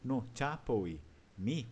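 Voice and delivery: No chapoi mi said slowly, rise on mi; slow Talian model. Shown as a demonstration. Teacher confirms the answer, slow No chapoi mi model. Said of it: "Isso aí".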